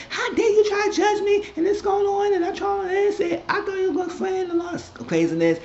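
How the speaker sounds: hammed-up outraged friend; mock heated outburst. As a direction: parody her offended shrieking. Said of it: "Ashley was getting heated".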